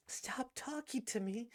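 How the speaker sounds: breathless, voiceless echo of the question; stunned whisper. Acted. She repeats his words in a shocked whisper. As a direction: whisper it back in disbelief.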